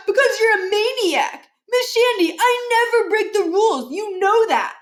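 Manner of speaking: whiny Josh protesting. Acted. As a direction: whine your innocence to the teacher.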